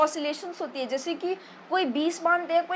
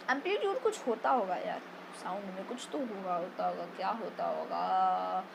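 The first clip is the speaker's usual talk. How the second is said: overacted pondering; 8 semitones lower, 9 dB quieter than usual. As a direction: ham up racking your brain under your breath. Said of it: "ज़्यादा acting हो गई". (सोचने का बनावटी अभिनय)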